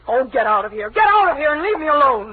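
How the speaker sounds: high, loud get out of here; hurt and furious, shouting; angry outburst. Acted. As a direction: throw him out in fury. She orders him out, upset.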